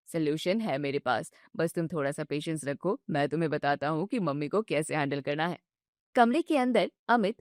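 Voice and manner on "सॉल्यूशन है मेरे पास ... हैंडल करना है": lower, gentle husband voice; calm her down patiently; soothing husband. Acted. Husband soothes his upset wife, low and gentle.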